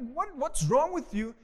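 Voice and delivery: high, slow, exasperated alarm; acted panic, pitched well up; freaking out. Disciples waking Jesus in the storm, freaking out. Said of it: "and they're freaking out".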